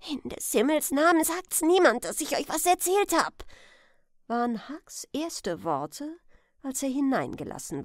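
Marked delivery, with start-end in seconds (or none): 0.1-3.4 put-on fast, loud, higher boy's voice; desperate plea. Huck begging the men to keep his secret, urgent plea. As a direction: implore them in a rush.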